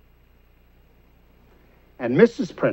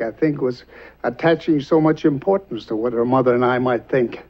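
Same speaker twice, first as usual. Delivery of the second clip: normal talking voice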